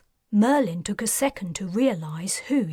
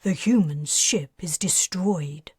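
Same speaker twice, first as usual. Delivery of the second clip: plain reading voice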